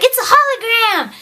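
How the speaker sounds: very high, squeaky exclamation; play-acted astonished outburst; mock shocked cry. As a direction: exclaim it in hammed-up alarm.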